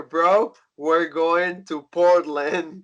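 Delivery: slow, drawn-out bro voice; dude-bro impression. Wrestling fan imitating the bro heading to Portland, drawn-out. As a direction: stretch it out like a laid-back bro. Imitating Matt Riddle's laid-back bro delivery.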